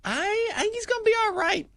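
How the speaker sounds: voice goes really high. Real, not acted. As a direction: squeak it up high. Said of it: "when people's voice goes really high".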